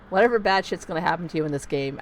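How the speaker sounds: ordinary talk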